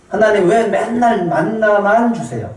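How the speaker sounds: mock a spoiled child nagging; whiny mockery. Mocking whiny Israelites.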